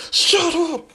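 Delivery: shrieking furious outburst; screeching shut up. Retold or imitated. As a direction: scream it in a tantrum. Kacchan yells at teasing.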